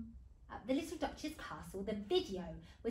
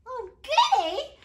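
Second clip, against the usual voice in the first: high, loud, drawn-out glee; big happy squeal for a child; gleeful mum; 12 semitones higher, 12 dB louder, slower than usual. Acted. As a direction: relish it with showy delight. Mum delights in the eggs.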